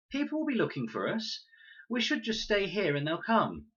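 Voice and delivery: put-on high girl's voice, insisting; Con, insistent. A narrator's reading. Con arguing against the raft, high insistent girl. Con's voice in read story.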